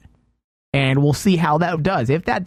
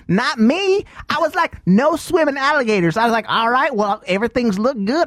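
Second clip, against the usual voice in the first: put-on hillbilly accent, raised pitch; 5 semitones higher than usual. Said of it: "going back into the redneck voice by accident".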